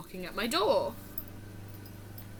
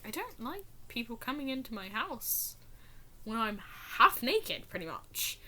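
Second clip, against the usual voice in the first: a touch of a blunt mom; 7 dB quieter than usual. Retold or imitated. Mom voiced.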